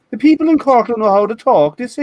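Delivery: high sing-song Cork tune, talk like a song; singsong Cork lilt. Shown as a demonstration. Telling friends how Cork people talk, high sing-song Cork lilt. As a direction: let the Cork accent rise and fall like a tune. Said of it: "everything is a song".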